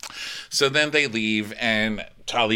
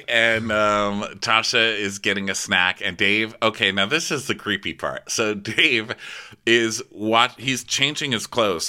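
usual speaking voice

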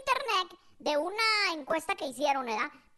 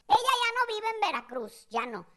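usual speaking voice

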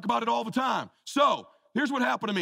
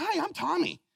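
pitched-up, innocent rookie greeting; eager young rookie; 11 semitones higher than usual. Retold or imitated. Rookie Brady introducing himself to veteran, lightly voiced. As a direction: sound like a skinny kid saying hi.

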